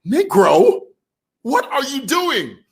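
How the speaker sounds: high-pitched, mock-outraged incredulity; comic shocked demand; imitated officer's disbelief. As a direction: act out the stunned officer's look in a high voice. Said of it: "Did you see the look on the other officer's face?".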